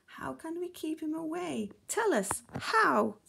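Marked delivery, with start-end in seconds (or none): 0.0-1.7 high-pitched, fretful panic; anxious high squeal of a fish; frightened fish. Acted. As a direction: plead in a high, fearful voice. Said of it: "We're afraid of the shark".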